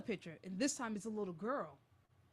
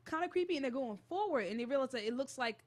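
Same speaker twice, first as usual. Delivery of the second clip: normal talking voice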